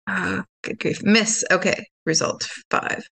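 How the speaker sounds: ordinary talk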